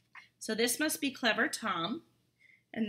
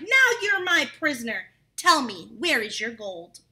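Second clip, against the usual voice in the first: loud, commanding capture; triumphant cry; 7 dB louder than usual. Acted. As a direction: shout it as you grab him. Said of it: "grabbed the sly fellow and cried".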